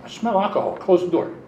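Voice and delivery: a commander sniffing out booze; stern commander. Retold or imitated. Commander confronting sailor in office.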